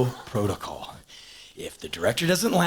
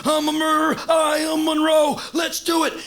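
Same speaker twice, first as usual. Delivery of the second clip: over the top; 3 dB louder than usual. Retold or imitated. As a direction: work yourself into a hammed-up, high yell. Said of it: "I totally went over the top".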